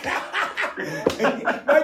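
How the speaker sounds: high laugh; burst of laughter. Real, not acted. Laughing at the joke.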